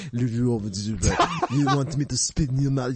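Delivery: slow, hammed-up Italian accent; weird Italian accent. Friend imitates the porn star's weird Italian accent, slow. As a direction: mimic the performer's odd Italian drawl. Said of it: "he just has the weirdest accent".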